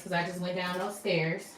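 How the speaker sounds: ordinary talk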